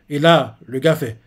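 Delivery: ordinary talk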